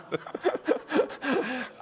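high, soft burst of real laughter. Real, not acted. Pastor laughing at knocking grandma down, real laugh. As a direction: laugh helplessly at the memory.

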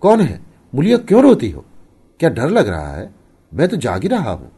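startled, high and loud questioning; alarmed. A narrator's reading. Husband woken by his weeping wife, flustered and alarmed. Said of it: "घबरा कर पूछा".

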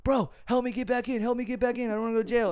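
hammed-up urgent pleading; mock desperate plea. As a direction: imitate a man begging to be let back in. Retold man begging to get back in.

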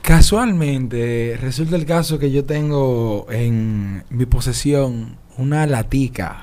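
milk each word like a solemn showman; grand product presenter. Acted. Pedro presenting the can to the hosts, slow pompous showman.

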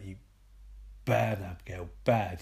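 low, soft mock reproach; hushed scolding. Hannibal walks over to Abigail, quietly scolding. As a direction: chide her quietly, deep in your range.

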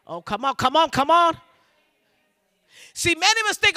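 ordinary talk, nothing marked

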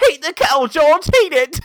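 high, loud hammed-up British shout; mock British accent. Friend orders George to the kettle, shouted mock British accent. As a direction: yell it in a comic British accent. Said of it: "like, British".

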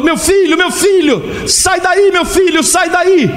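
grito bem mais agudo, em pânico; grito desesperado. Acted. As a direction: grita para salvar o filho. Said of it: "ele dá um grito". (pai gritando desesperado para o filho)

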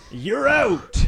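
loud, high-pitched umpire shout; umpire call. Acted. Imitating an umpire for the others, loud drawn-out call. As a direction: belt it out like an umpire. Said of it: "like an umpire".

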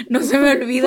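a laughing, tearful catch in the voice; laughing, half crying. Real, not acted. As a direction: laugh it out like you might cry. Laughing at the memory.

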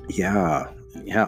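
ordinary delivery

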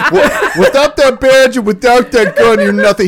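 high, loud, laughing parody of a line; hammed-up challenge through laughter; mocking movie taunt. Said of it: "He says that so many fucking times".

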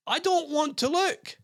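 high, slow squeaky terrified whine; a woman seeing a leech on her leg, mock terror; mock frightened squeal. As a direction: play her horrified and wailing. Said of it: "screams again before saying".

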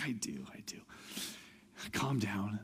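soft, sleepy mumble imitating someone roused; quietly soothe, half asleep; just woken. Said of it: "he wipes the sleep out of his eyes".

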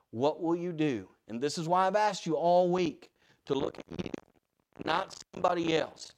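normal talking voice

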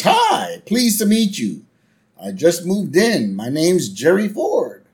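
high, drawn-out neighborly greeting; Gerald Ford. Retold or imitated. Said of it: "President Gerald Ford".

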